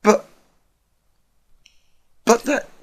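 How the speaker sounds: high, halting stammer in shock. Acted. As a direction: stumble over the words, stunned. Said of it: "Twilight stammered".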